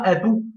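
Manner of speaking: abou with an oo vowel after the b; wrongly added ou. Shown as a demonstration. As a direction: end abou on a clear ou syllable. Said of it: "il n'y a pas de ou". (abou avec un ou final en trop)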